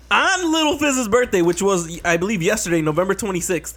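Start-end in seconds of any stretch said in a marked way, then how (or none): 0.1-1.4 higher, louder, dramatic repeat; excited build-up. Shown as a demonstration. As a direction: hype it up for the reveal.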